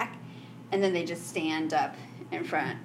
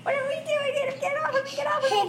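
much higher, frantic pleading; panicked squeal; faster than usual. Acted. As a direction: shriek like you're being carried off. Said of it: "And he's like".

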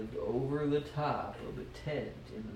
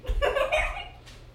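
high, airy giggle; short real laugh; 4 dB louder than usual. Real, not acted. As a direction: giggle at a silly line. Laughing at the story.

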